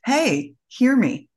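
slow, pressing call to be heard; earnest but understated demand for attention; mild mock plea to be heard. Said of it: "our voice may come out louder".

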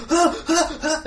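high panicked gasps; panicked gasping. Retold or imitated. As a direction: gasp in fright, hammed up.

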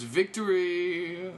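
high, long-drawn victory cry; mock triumph. Comedian imitating her winning the joke challenge, mock triumph. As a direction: stretch the word out in playful celebration.

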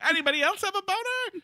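very high, fast squeak; high silly voice. Acted. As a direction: squeak it out goofily. High mocking question.

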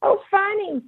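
put-on very high, gleeful little-girl voice; imitated giggly toddler. Toddler at the table to mama, mock hysterical glee. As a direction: mimic a toddler tickled by something, squealing high. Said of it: "then she's just laughing".

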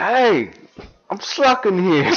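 high, loud Jersey parody; mock Jersey accent. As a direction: yell it like an indignant Jersey local. Parody of walking-here catchphrase.

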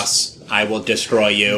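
low, slow, half-whispered menacing drawl; low and slow like a nerdy would-be villain; Kyle impression. Friend mimics Kyle's voice for the podcast, low and slow. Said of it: "That sounded freakishly like him".

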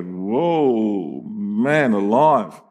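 slow, low, drawn-out amazement; awed whoa. Retold or imitated. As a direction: let the awe stretch out. Awed reaction of the fellas.

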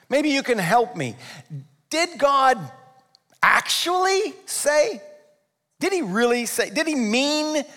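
sly tempter. Acted. Satan coaxing Eve with doubts, sly feigned innocence. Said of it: "It's sort of like".

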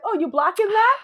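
high, playful voice of the brother; brother asking. Retold or imitated. Brother checking her knitting, playful and high. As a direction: ask it like an impressed Brooklyn brother. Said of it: "He's like".

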